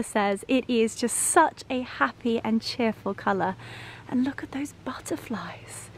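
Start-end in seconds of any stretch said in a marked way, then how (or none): 4.1-5.9 hushed whisper at the butterflies. Real, not acted. Owner showing viewers butterflies, in a whisper. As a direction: breathe it out in awe.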